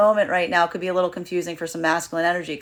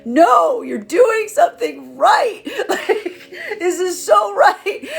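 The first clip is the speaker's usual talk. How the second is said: much higher, louder, slow laughing cry; laughing through tears; 13 semitones higher, 7 dB louder, much slower than usual. Retold or imitated. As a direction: laugh and half-cry the words out, high and loud. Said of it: "feminine's gonna be like".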